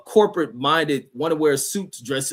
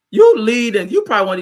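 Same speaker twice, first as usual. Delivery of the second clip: emphatic outburst; 9 semitones higher, 9 dB louder than usual. Real, not acted. Ranting at a chatter.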